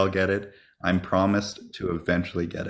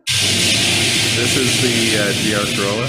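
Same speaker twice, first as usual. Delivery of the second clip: drawn-out delivery; 2 semitones higher, 7 dB louder, slower than usual. Real, not acted. Drawn out over burp.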